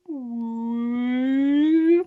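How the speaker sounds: long, drawn-out cat meow. Pretend. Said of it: "it always needs a da-da".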